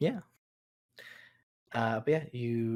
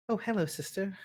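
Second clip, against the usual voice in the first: higher-pitched in-character greeting; sister's greeting; 6 semitones higher, 3 dB quieter than usual. Acted. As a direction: greet your sister in a lightly put-on higher voice. Game master voices sister's greeting.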